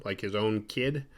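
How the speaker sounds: normal talking voice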